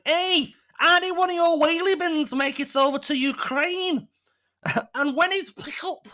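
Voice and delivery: high, loud put-on voice calling out; acted jokey questioner. Man teasing the council on Twitter, acted jokey voice. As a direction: pitch it way up, hammed-up and cheeky. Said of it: "I've gone to some crazy accent".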